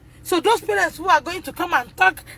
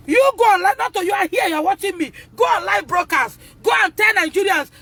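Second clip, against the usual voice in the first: angry shout; 5 semitones higher than usual. Real, not acted. Mother shouting a dare at the school. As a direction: yell the challenge at them.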